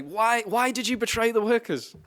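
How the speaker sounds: high-pitched mock dismay; dismayed reformist. Mimicking reformists shocked by Biden's betrayal, high-pitched mock dismay. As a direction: wail it like a wronged innocent.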